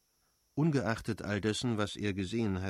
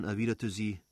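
ordinary delivery, nothing marked

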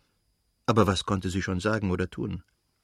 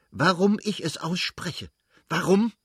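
angry; 9 semitones higher than usual. A narrator's reading. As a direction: hurl the question back furiously. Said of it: "fuhr er genauso zornig fort".

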